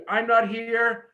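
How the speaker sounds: ordinary delivery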